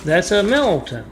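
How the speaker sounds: angry outburst. Retold or imitated. Man catching his girlfriend's plate, angry yell. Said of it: "And he blows up".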